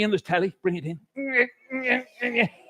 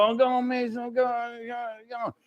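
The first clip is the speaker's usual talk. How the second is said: higher, fading begging whine; whiny pleading; 4 semitones higher, 7 dB quieter than usual. Retold or imitated. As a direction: wheedle and trail off. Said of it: "the kid would go".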